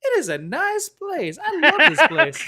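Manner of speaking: high cartoon sidekick swooning; Donkey voice. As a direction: gush over the place in a high, silly voice. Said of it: "He's like".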